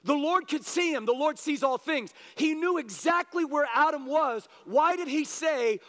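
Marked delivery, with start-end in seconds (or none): none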